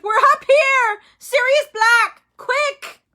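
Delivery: very high, loud, slow scream; desperate scream. A narrator's reading. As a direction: scream for help upstairs. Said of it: "Hermione screamed".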